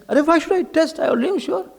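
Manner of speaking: high-pitched, dismissive protest; cocksure objection. Acted. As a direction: brush the advice off, already certain. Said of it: "speaks with lot of confidence".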